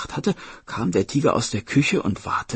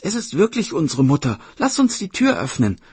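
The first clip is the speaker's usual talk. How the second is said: put-on high, loud excited child; sister calling eagerly; 6 semitones higher, 7 dB louder than usual. Sister urges opening the door, high excited call. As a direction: cry out convinced, as the sister. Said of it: "rief die Schwester".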